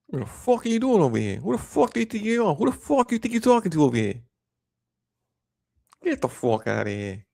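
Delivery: high-pitched, indignant Northeast rant, dropping at the end; Jersey accent. Acted. Showing how Northeasterners swear, indignant Jersey accent. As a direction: pile up questions like a riled Jersey local. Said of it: "people from the Northeast or whatever".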